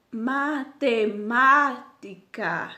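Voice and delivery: "Matemática" very slow, syllable by syllable, MÁ loudest; stress on third syllable. Shown as a demonstration. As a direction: break up "Matemática" and lean long on the second MA. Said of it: "Então, o ma".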